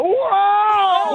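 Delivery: high thrilled whoa; on a roller coaster. Pretend. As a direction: squeal like dropping down a coaster. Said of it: "Pretend we're on a roller coaster right now".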